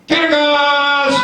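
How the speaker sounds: rising shout of Kyrgyz; demo shout. Shown as a demonstration. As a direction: shout it for them to copy. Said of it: "shout out as loud as you can".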